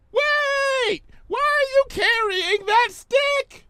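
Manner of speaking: very high, loud call; shouted after her. Acted. Pinky shouts after the fleeing Fluttershy, high call. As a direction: yell across the distance. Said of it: "Pinky shouted after her".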